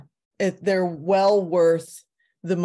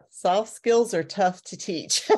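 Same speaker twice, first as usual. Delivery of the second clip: laughing through the line; 2 semitones higher than usual. Real, not acted. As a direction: chuckle it out.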